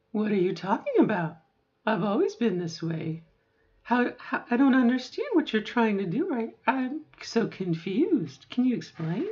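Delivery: high, puzzled, bewildered reply; genuinely confused. Pretend. Robin answering the friend, high and puzzled, bewildered. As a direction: play it baffled, as if you've forgotten. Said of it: "And you're like".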